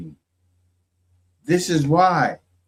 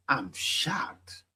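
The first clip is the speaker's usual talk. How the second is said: breathy whisper, much softer; whispered aside; 12 dB quieter than usual. Real, not acted. Commentator whispering shock at the clip to viewers. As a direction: lean in and whisper it.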